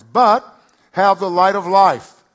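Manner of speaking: loud, high, slow proclamation; preaching climax. Acted. Pastor proclaiming the promise to the congregation, loud and slow. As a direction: ring it out to the rafters.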